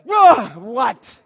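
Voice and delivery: loud, high startled "whoa"; startled shout on waking; startled awake. Retold or imitated. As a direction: jolt out of sleep, alarmed.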